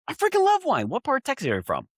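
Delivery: imitating a thrilled comedian; excited squeal. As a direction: shriek with delight at the news. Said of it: "I was like".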